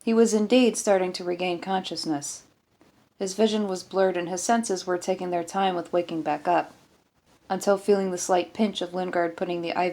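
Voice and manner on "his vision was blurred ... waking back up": usual speaking voice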